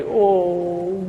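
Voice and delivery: a light mock coo over a baby. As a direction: gently imitate someone charmed by a baby. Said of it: "they see a little baby".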